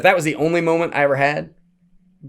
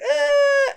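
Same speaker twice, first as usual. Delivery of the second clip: imitated scared yelp; 5 dB louder than usual. Other girl scared.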